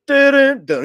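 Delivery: loud high "dun dun"; high, punchy vocal game fanfare; imitated game jingle. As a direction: belt out a mock game start-up sting. Imitates the game's startup sound.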